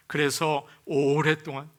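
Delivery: usual speaking voice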